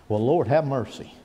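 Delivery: ordinary talk, nothing marked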